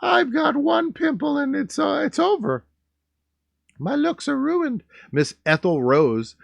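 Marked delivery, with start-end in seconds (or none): 0.0-4.8 put-on much higher dramatic girly voice; high, tragic mock despair; mock whiny girl. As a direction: wail it like a melodramatic teen. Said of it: "She's like".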